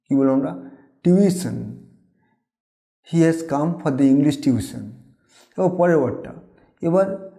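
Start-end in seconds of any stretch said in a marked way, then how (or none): 1.0-1.8 "টিউশন" said as tyu-i-sən, weak last vowel; correct pronunciation. Shown as a demonstration. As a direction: say "টিউশন" with an "i" glide before -sən. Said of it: "এর সঠিক উচ্চারণ হচ্ছে". ("টিউশন" সঠিক উচ্চারণে, টিউইসন)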